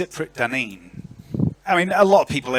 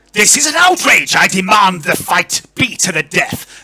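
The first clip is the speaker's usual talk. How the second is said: very loud, high fury; outraged scream; 8 semitones higher, 11 dB louder than usual. Acted. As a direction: apoplectic lord demanding blood. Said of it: "screams Lord Verence".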